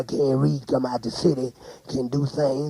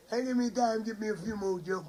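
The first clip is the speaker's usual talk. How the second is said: high, soft, laughing words; 10 dB quieter than usual. Real, not acted. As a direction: talk through laughter. Laughing while talking.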